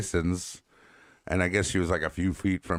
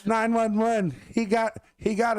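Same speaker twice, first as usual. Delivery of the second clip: mock panicked call. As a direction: pretend to call for help in a panic. Imitating panicked call in story.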